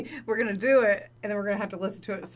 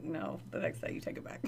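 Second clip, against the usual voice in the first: whispered; 3 semitones lower, 10 dB quieter than usual. Retold or imitated. Voicing friend's reply, whispered.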